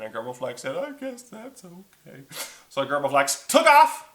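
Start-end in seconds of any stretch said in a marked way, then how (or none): 0.8-2.2 soft, high, slow creature voice; quiet, high-pitched giving in; meek dragon. Acted. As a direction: agree in a small reluctant voice. Dragon gives in.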